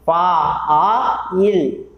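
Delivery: "फा, आ, इल" very slowly, each vowel held apart; broken into sounds. Shown as a demonstration. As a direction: stretch and separate "फा, आ, इल". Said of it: "फिर से pronounce कीजिए आप". ("फा, आ, इल" धीरे, स्वर अलग-अलग खींचकर)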